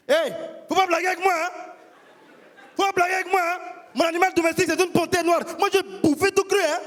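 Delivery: put-on high, fast, heavy African accent in a temper; the cousin losing his temper, heavy African accent; angry African accent. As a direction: threaten him in a thick African accent. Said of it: "cet accent africain prononcé ... quand il se fâchait".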